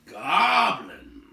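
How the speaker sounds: loud alarmed echo; surprised. A narrator's reading. Big man hearing of goblins, loud shocked echo. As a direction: bark the word back in shock. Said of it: "less gruffly".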